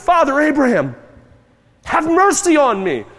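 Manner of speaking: loud, high agonized plea; emphatic cry. Acted. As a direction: cry out high, begging for mercy. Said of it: "I am emphasizing that with my voice".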